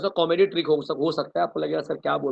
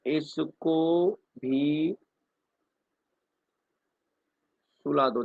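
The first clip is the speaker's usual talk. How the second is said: drawn-out recital; much slower than usual. Shown as a demonstration. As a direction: stretch the phrase for the board. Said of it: "sentence formation के लिए". ("इसको भी" बहुत खींचकर, फिर "सुला दो" नीचे)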